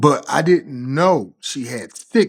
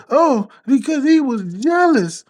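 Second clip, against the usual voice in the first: much higher, mock girlfriend answering; girlfriend's voice; 12 semitones higher than usual. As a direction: put on her high, excuse-making voice. Girlfriend voiced in retold quarrel.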